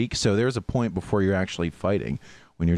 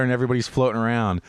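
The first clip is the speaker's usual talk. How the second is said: ordinary delivery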